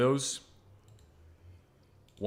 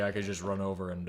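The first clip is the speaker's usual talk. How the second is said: normal talking voice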